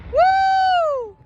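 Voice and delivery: high, loud "woo"; long cheering whoop; excited whoop. Real, not acted. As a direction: whoop it out at the fireworks. Cheering at fireworks show.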